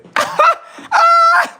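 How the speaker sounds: high, loud shrieking laugh burst. Real, not acted. Gamer cracking up, shrieking with laughter. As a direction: let out a high cackling shriek.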